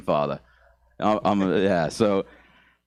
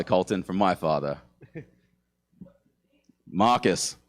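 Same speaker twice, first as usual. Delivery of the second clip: ordinary delivery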